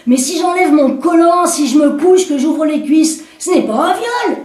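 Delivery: woman protesting to the commissaire; indignant woman. Acted. Woman pleads her case.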